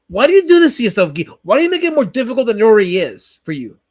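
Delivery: ordinary talk, nothing marked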